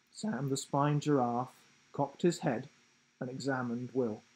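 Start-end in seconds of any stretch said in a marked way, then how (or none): none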